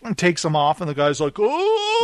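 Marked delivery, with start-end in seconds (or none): 1.4-2.0 very high, drawn-out mock gasp of alarm; startled "oh". Guard seeing the cuffs come off, high mock alarm. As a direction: whoop a high, comic "oh" of shock.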